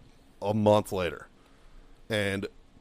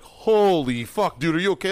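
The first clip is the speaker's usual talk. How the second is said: shocked awe; 5 semitones higher, 5 dB louder than usual. Retold or imitated. Stunned sergeants checking on their screaming boss, shocked awe. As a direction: imitate stunned onlookers, stretching the first words.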